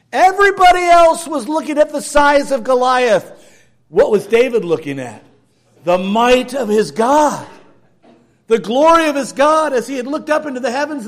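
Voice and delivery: loud, high sermon proclamation; full-force preaching. Acted. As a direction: proclaim it to the back row.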